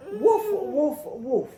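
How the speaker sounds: loud high playful barking woofs; big cheerful puppy woofs; dog woof. Pretend. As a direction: bark like a friendly dog for the kids. Dog imitation for kids.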